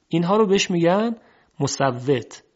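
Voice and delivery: ordinary talk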